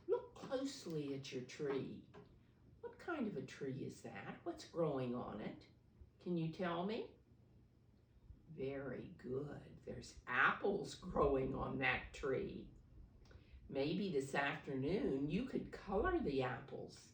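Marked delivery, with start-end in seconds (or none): none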